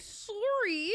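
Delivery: high drawn-out sorry; playful high-pitched sorry; playful apology. Real, not acted. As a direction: pitch it way up, falling at the end. Echoing co-host's apology after mishap.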